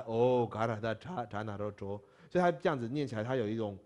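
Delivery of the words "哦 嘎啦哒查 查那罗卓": refined reading. Shown as a demonstration. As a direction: recite it the scholarly way. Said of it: "文人的念法的话是什么".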